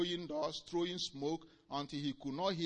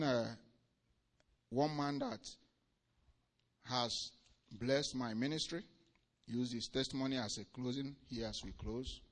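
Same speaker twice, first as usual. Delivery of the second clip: ordinary talk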